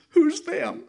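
put-on high, small, loud child's question; a child's high worried voice; little child's voice. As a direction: ask it like a scared little kid. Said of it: "He says".

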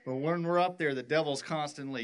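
ordinary talk, nothing marked

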